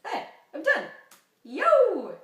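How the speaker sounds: high, loud shout of triumph; gleeful yelling; victory cheer. Real, not acted. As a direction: celebrate finishing the review out loud. Celebrates finishing the review.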